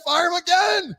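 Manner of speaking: high yelled boss voice; mock owner shout. Urging the owner to fire the coach, high mock shout. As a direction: bark it like a gleeful owner.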